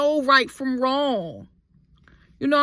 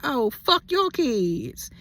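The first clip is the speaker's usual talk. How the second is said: slow defiant retort; 3 semitones higher, slower than usual. Retold or imitated. Firing back in retold fight.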